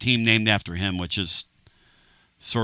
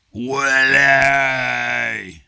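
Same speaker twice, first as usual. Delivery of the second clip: long booming call of Willie. Real, not acted. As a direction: hail him across the room. Calling out to Will.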